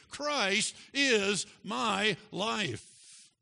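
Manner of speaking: high, loud, very slow, word by word; drawn-out, forceful proclamation; climactic declaration. Real, not acted. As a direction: hammer out every word to the congregation. Preacher's climactic restatement.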